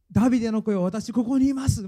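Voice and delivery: acted plea, lifted in pitch; desperate cry. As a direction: call out insistently to be noticed. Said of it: "ひたすら叫んだ".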